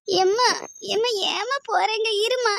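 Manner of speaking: put-on high, slow pleading child's cry; a child begging its mother not to go. (அழும் குழந்தையின் கெஞ்சல்)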